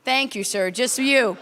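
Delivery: usual speaking voice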